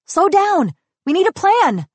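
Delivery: high, loud calling after someone; urgent cry. A narrator's reading. Asha chasing Star toward the castle, high urgent shout. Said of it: "Asha cried, running after Star".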